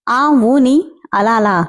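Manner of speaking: slow, drawn-out mocking tone; mocking drawl. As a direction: drag the words out to mock her. Mocking the demanded 'aa oo'. (వెక్కిరిస్తూ సాగదీస్తూ)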